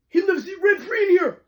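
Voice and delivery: high-pitched fangirl squeal; excited squeal. Real, not acted. Reader gushing over a fictional crush, squealing high. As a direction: shriek it like a smitten fan.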